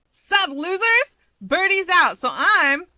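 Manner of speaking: loud, high, brash tomboy voice; booming cocky hero barging in; brash Mirko. A narrator's reading. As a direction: swagger in, shouting a greeting. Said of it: "cuts herself off, grinning".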